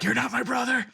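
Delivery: high-pitched imitation of a yell; mock yell. Sibling disowning their brother, imitated high yell. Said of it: "somebody yelling".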